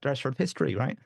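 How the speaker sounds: ordinary delivery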